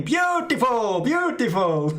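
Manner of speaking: high, sing-song mock Spaniard crooning "beautiful". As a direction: ham up a Speedo-clad Spaniard admiring everything. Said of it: "only saying".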